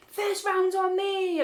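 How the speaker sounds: high, drawn-out merry holler; mock drunken sing-out, stretched and high; drunken announcement. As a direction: call out a round to the whole bar, imitating a drunk friend. Drunk friend calling out her offer.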